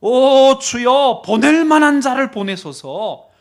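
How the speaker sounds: loud, high imploring protest; drawn-out dramatic plea; arguing back. Acted. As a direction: cry out to the Lord in objection. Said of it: "한 번 더 따집니다".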